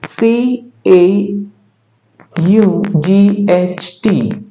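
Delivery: slow letter-by-letter spelling; यू जी H T said one letter at a time, slowly; spelled out slowly. Shown as a demonstration. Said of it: "उसी तरह से".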